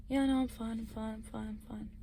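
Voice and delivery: imitated sleepy reply, fading to very soft; dozing mumble. Storyteller as half-asleep friend answering, dozing and fading away. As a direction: trail off as if dropping back to sleep. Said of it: "Like, just totally dozing".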